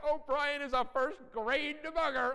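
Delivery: high, loud, slow teasing mom; mock the son with his own review line; mother teasing her son, playfully mocking. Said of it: "she's like".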